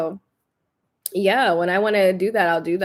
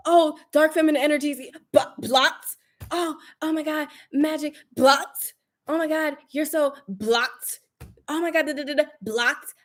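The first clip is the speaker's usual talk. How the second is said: higher, airy mock-gushing voice then curt "blocked"; 5 semitones higher than usual. Mocking people who push energies on her, gushing then dismissive. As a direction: gush then cut them off. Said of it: "I'll be like".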